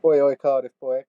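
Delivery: loud cheery oi oi; bellowed mate greeting. Acted. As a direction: call out a hearty hello.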